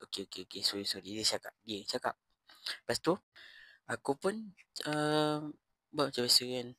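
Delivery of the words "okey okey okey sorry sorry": much softer, mumbled sorry; imitating the classmate's soft sorry; meek apology. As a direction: back off quietly, a little sheepish. Classmate backing down after warning.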